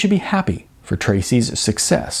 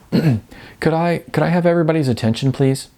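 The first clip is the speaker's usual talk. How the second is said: lightly voiced stranger, raised a little, quick; stranger calling out; 6 semitones higher than usual. Stranger calling the class to attention, lightly voiced and quick. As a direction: call the room to attention, a touch higher. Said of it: "cleared his throat and called out".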